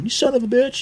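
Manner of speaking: high mock-furious yell at a driver; road rage yell. Cursing the driver who cut him off, high angry yell. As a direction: let the curse fly up high, mock outrage.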